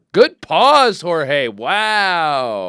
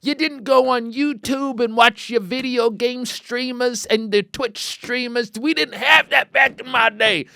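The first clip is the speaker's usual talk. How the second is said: high, slow mock old man grumbling; old-timer rant; 11 semitones higher, 4 dB louder, slower than usual. Joking about the old days to listeners, mock old-timer voice. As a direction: play a crusty grandpa.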